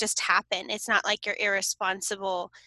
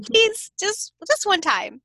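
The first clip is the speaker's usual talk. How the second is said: high pleading; 8 semitones higher, 4 dB louder, slower than usual. Retold or imitated. Retold plea to son.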